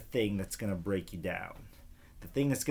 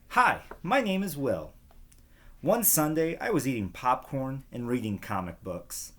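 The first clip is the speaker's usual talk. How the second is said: put-on bright, higher storytelling voice for young listeners; reading to children; 4 semitones higher than usual. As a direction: read it like a picture book to kids.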